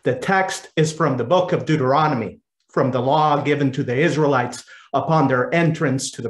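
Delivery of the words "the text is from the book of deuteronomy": ordinary delivery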